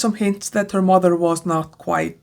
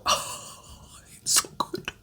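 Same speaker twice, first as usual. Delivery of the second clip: voiceless, overcome whisper; breathless whisper; 14 dB quieter, much slower than usual. Real, not acted. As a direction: barely get the words out, moved. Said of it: "sorry about my voice".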